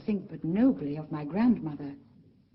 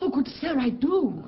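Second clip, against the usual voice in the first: quick, higher, louder protest; startled, earnest reassurance; eager protest; 5 semitones higher, 5 dB louder than usual. Acted. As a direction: insist you are listening, a little stung. Miranda protests she is listening.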